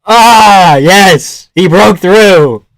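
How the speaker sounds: loud, drawn-out victory cry; real elated shout; triumphant yell. Real, not acted. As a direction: cheer loudly, stretching the words. Celebrating the win live.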